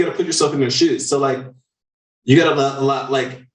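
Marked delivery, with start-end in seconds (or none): none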